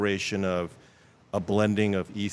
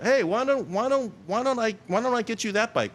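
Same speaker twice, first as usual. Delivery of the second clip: much higher, bright mock-friendly boss offering a bike; boss's voice; 13 semitones higher than usual. Retelling how the boss offered his bike, in a high mock-cheery voice. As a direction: put on a chirpy high voice for the boss. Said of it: "And so it's like".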